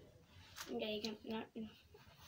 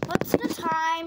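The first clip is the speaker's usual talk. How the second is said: much louder, slow question; 2 semitones higher, 12 dB louder, slower than usual. Real, not acted. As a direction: call it out, slowly.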